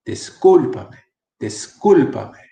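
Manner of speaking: discúlpame stressed third from last, dis-CÚL-pame; discúlpame drawn out, with CÚL standing out; correct stress demo. Shown as a demonstration. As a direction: slowly punch the "cúl" in discúlpame. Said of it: "third from the last vocale has the accent".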